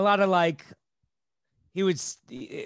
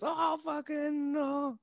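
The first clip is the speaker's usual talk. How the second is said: high, slow mock groan of a crowd; audience groan; 11 semitones higher, 6 dB quieter, much slower than usual. The audience groans at the comic's line, high and slow. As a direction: drag it out like a stung crowd. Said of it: "the audience just goes".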